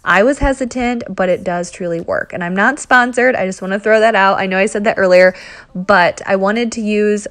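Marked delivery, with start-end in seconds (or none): none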